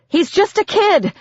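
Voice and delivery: high-pitched, pleading shout. A narrator's reading. Narrator to demon shielding Biz, high shouted plea. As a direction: shout in desperate protest. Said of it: "I shouted at Al".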